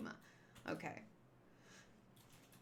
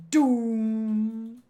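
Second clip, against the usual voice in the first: deeper, louder villain doom; booming doom; 3 semitones lower, 5 dB louder than usual. Acted. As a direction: boom it out like a villain. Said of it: "that was not a very good doom".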